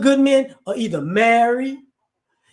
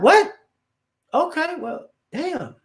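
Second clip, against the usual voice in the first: shocked what; 7 semitones higher, slower than usual. Retold or imitated. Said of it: "And he like".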